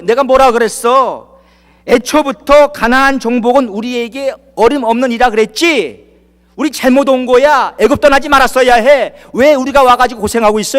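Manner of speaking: mock whiny griping, pushed loud; grumbling complaint. Israelites complaining to each other at the Jordan, mock gripe. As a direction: act out the people's grumbling at the river. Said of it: "막 불평, 원망하는 거예요".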